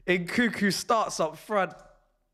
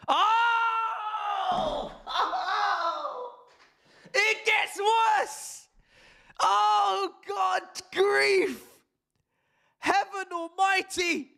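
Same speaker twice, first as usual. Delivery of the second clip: high shouted outrage, slow and stretched; shocked shout; 13 semitones higher, much slower than usual. Real, not acted. Fan sees the team sheet, shouts in high shock. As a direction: cry out in horror at the news.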